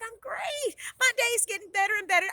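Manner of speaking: high, cheerful gush; upbeat delight. A narrator's reading. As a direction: answer brightly, thrilled with the day. Heroine cheerfully answers her brother.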